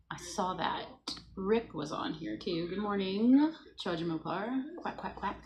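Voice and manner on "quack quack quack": soft unvoiced duck quacks; whispered quacks. Reading a duck emoji to the chat as soft whispered quacks. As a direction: quack under your breath.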